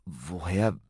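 slow, halting question; hesitant, broken-up stammer. A narrator's reading. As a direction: falter as if caught out. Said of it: "stammelte Ben".

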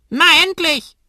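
schrilles, sehr hohes Papageienkrächzen; krächz es wie ein Vogel; krächzender Kakadu. A narrator's reading. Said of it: "krächzt es aus der linken Ecke". (Kakadu krächzt laut und hoch)

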